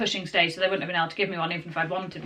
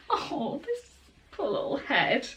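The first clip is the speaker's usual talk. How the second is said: tender sympathy; 5 semitones higher, 6 dB quieter, slower than usual. Real, not acted. Mother to baby about her poor little head, cooing sympathy. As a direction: coo softly with pity for a little one.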